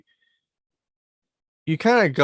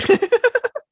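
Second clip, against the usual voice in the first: high laugh; 8 dB louder than usual. Real, not acted. Laughs at the chair mishap.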